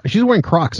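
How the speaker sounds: ordinary talk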